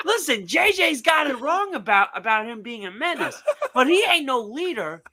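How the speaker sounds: high ranting host, laughing through it; Mad Dog impression. Still ranting as Mad Dog about Spider-Man's leadership. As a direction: keep the hot-take rant going. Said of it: "he'd be like".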